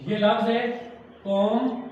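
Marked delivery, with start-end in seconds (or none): 1.3-1.8 companion broken into three parts with long pauses; syllable by syllable. Shown as a demonstration. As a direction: read companion as separate syllables, pausing after each. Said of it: "इसको पढ़ते हुए आप कितने लफ्ज़ों में तोड़ सकते हैं".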